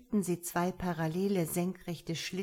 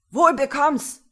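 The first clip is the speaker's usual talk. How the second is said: much louder, higher jeering yell; mocking shout; 8 semitones higher, 13 dB louder than usual. A narrator's reading. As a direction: yell a taunt after the shot. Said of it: "schrie er ihm hinterher".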